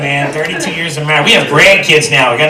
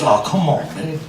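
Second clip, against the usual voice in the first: lower, softer put-upon groaning; weary wife; 5 semitones lower, 11 dB quieter than usual. Acted. Wife of twenty years turns husband down, weary low voice. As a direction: fend him off wearily, low and soft.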